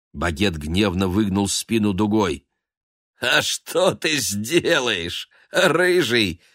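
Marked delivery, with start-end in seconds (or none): 3.2-6.4 higher, slow sneering challenge; taunt. A narrator's reading. Noir taunting Baguette from below, slow sneer. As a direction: lean on "что" with scorn.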